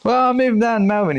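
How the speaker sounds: high, quick put-on voice; mock high-voiced announcement of news; high-pitched retold reply. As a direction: pitch it right up and rattle it off. Retold phone chat, own reply.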